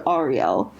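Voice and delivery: Ariel opening on AH, "AH-ree-el"; Hebrew pronunciation. Shown as a demonstration. Convert announcing a chosen Hebrew name, Ariel said "AH-ree-el". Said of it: "in Hebrew it's pronounced Ariel".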